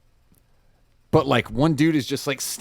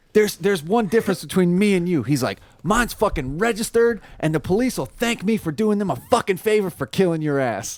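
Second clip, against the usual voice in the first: raised, cocky threat; mock menacing threat; 7 semitones higher than usual. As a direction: lay out the threat with swagger.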